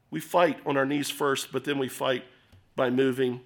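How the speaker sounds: ordinary talk, nothing marked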